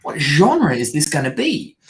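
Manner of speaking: baffled inner voice. Retold or imitated. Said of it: "You kind of think".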